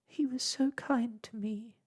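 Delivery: put-on a small girl's lament; wailing. A narrator's reading. Ragged Blossom grieving for her friend, a high mild wail. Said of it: "wailed Ragged Blossom".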